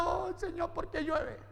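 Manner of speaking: softly hammed-up whimper; mock crying. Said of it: "imagínense llorando".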